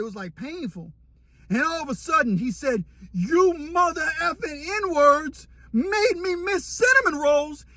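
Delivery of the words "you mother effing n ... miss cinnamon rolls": high, slow, crying complaint; mock anguished wail. Cellmate wailing at the dorm over missed cinnamon rolls, slow high crying. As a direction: drag out the wail like a heartbroken giant.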